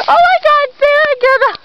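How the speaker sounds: high-pitched, loud hammed-up alarm; mock panic. Friend acting out an emergency with Sarah, mock panic. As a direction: wail it in pretend panic.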